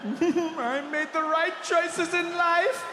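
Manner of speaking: high, slow, squeezed gloat breaking into loud laughter; hammed-up schadenfreude glee; gleeful gloating. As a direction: savour it smugly, then cackle. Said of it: "you get that little feeling inside".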